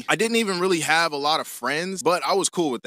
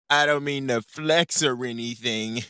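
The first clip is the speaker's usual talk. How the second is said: ordinary talk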